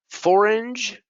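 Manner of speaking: "Forange" said with a sounded G and hard ending; mock-wrong "Forange", high; playful wrong form. Shown as a demonstration.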